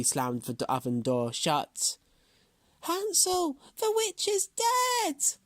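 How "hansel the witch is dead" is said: put-on high, slow joyful girl's cry; excited cry. A narrator's reading. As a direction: call out the good news. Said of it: "cried Gretel".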